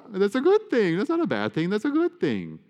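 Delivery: high, loud, jokey protest; playful insistence. Acted. Priest joking with congregation about converts, playful insistence.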